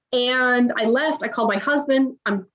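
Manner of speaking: ordinary delivery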